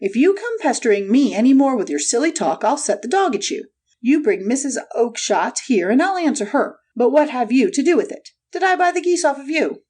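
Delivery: high-pitched irate salesman's outburst; angry shout. A narrator's reading. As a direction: rail at a pest in a high character voice. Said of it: "he shouted".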